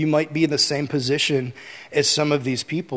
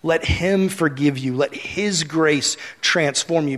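normal talking voice

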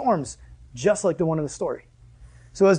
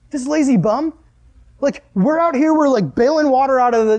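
higher-pitched, exasperated griping; indignant complaint; 6 semitones higher than usual. Acted. Disciples bailing water while Jesus sleeps, indignant. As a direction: act out fed-up crew grumbling about a slacker.

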